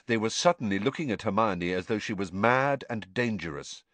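ordinary delivery

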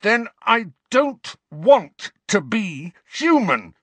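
put-on furious boy roaring at the headmaster; roared. A narrator's reading. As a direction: roar it in despair. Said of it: "Harry roared".

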